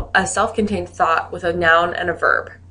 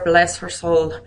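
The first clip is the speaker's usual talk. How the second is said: put-on Irish accent; Irish-accented aside; slower than usual. Shown as a demonstration. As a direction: say it as an Irish storyteller would. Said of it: "use my best Irish accent here".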